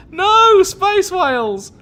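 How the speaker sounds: high, loud wail with drawn-out words; long, high-pitched shouts of protest; anguished protest. Real, not acted. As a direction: cry out in dismay for the helpless. Mock outrage at attack on whales.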